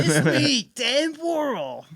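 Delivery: Dan Warhol. Acted. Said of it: "quoting our own bit of Dave doing".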